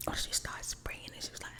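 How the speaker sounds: ordinary delivery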